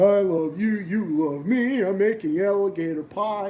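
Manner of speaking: a hint of the purple dinosaur crooning; mock Barney singsong. Streamer mocking Barney to chat, mild singsong. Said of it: "It's fucking".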